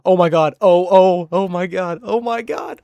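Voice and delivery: loud, high panicked oh my gods; imitating a group freaking out on the stairs. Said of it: "And we're going".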